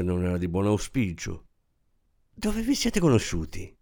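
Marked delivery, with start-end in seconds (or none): 2.3-3.8 absorbed cheerfulness. A narrator's reading. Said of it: "con lo stesso tono di assorta allegria".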